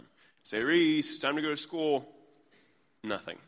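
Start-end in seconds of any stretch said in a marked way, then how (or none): 0.6-2.1 higher, louder retold call from the hall; second call. Dad calling daughter a second time, raised louder voice. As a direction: call the child with more push. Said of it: "I say it a second time".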